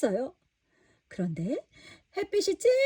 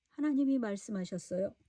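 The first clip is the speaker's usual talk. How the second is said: ordinary delivery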